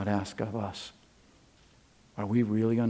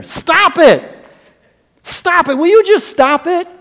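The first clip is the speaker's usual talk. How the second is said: exasperated scolding; 13 dB louder than usual. Acted. Paul scolding the church, voiced.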